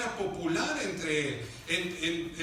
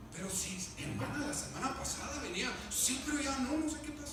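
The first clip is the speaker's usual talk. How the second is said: diálogo en voz baja; 2 semitones higher, 8 dB quieter than usual. Acted. Diálogo imitado de creyentes.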